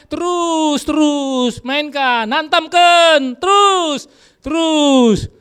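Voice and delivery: high, loud taunting chant of terus; mock devil cheering. Preacher voicing the devil egging couples to fight, high and loud. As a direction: egg them on like a gleeful devil.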